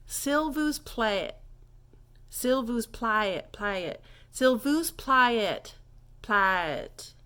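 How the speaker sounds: "s'il vous plaît" slow, "plaît" drawn into plah-it; drawled French demo. Shown as a demonstration. Teacher showing please in French, slow drawled demo. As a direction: stretch each word of "s'il vous plaît", plaît as two syllables. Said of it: "get your tongue around the French language".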